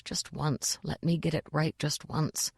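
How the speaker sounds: soft, low private plea; hushed, wishful muttered thought; inward thought. A narrator's reading. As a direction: think it quietly to yourself. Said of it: "he thought".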